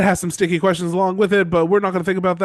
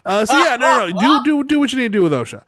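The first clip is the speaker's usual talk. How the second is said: high, fast hurried backpedal; flustered maester; 9 semitones higher, 6 dB louder, faster than usual. Retold or imitated. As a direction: rush to take it back. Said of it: "Lumen's like".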